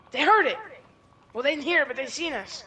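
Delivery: high, loud alarmed call; excited shout. Pretend. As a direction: yell the alarm to your partner. Ambush spotted during shootout.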